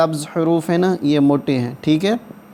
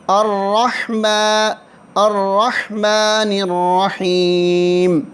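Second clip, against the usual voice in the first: slightly raised, steady chanting of the verses; Quranic recitation; 5 semitones higher, much slower than usual. Shown as a demonstration. Teacher reciting Fatiha line by line for students, chanting. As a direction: recite each phrase slowly and repeat it.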